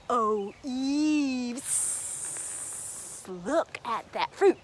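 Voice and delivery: low hissing serpent; sly serpent. Acted. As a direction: slide into temptation. Serpent points at fruit.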